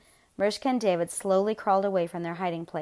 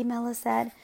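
ordinary delivery

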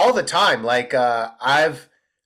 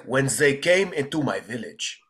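put-on Middle Eastern accent for a hacky role; Middle Eastern refugee accent. Actor playing a refugee in a one-line audition, Middle Eastern accent. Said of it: "refugee or terrorist roles".

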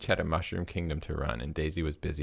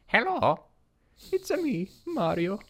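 Mario voice; 4 dB louder than usual. Acted. Mario voiced in read story.

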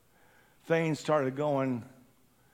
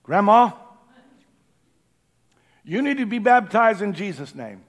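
put-on loud, higher call to the old lady; urgent call; 6 semitones higher, 10 dB louder than usual. Preacher telling the grandmother she must be baptized, loud. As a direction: call out to her firmly. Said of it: "And I said".

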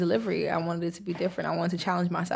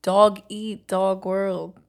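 put-on very slow, stretched Brooklyn accent; 5 dB louder, much slower than usual. Showing off her Brooklyn accent to a friend, slow. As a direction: drag it out in a hammed-up Brooklyn accent. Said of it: "I have a little Brooklyn accent".

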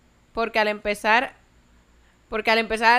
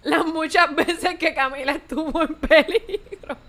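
higher voice, bursting into laughter; laughing while talking; 5 semitones higher, 8 dB louder, slower than usual. Real, not acted. Host reading Camila's past mishaps, cracking up. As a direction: let laughter take over the words.

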